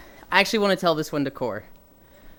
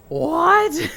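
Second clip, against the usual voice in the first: shocked owner; 11 semitones higher than usual. Retold or imitated. Owner shocked, ranger answers.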